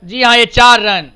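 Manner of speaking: loud, high boundary call; rising to a big shout on the four; excited cricket commentary. Real, not acted.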